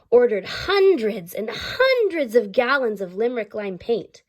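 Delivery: plain reading voice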